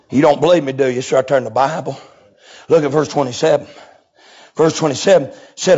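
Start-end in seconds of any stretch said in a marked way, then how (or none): none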